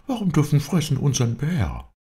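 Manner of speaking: high, outraged character voice; Bob, indignant. A narrator's reading. Bob complains that his bear was eaten, high indignation. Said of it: "Die Indignation des guten Schwarzen".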